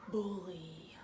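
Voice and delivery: hushed dramatic whisper. Acted. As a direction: breathe the word bully. Dramatic reveal.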